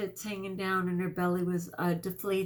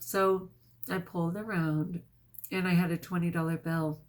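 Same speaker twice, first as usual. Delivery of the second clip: ordinary talk, nothing marked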